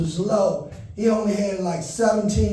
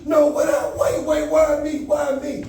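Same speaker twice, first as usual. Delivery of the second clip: higher, frantic mock-crying plea; panicked pleading cry; 7 semitones higher than usual. Comic thrown into the hot seat, pleading higher in mock panic. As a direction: beg for your life, half-laughing. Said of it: "And I was crying like".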